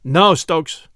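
put-on captain refusing the old soldier; firm officer's no. A narrator's reading. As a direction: stop him kindly but firmly. Captain stops Stokes.

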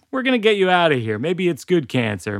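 quick, high, chirpy mock mom; cheery good cop mom. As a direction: hurry out a bright, upbeat mom line. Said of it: "my mom is like".

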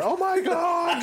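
high, loud panicked cry; mock panic. Retelling pushing on the haunted wall, high panicked cry. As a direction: cry out like you're trapped.

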